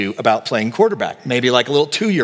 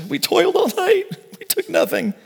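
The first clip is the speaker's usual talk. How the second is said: pitched way up, a worn-out fisherman's whine; desperate complaint. Retold or imitated. Peter protesting to Jesus after fishing all night, desperate high whine. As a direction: plead it like you're at the end of your rope. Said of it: "this is desperation that he's doing".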